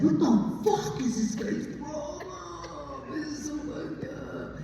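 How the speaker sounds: high, slow, stretched-out shocked shout; appalled outburst. Retold or imitated. Reading American Psycho for the first time, appalled high shout. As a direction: let the disgust burst out slowly.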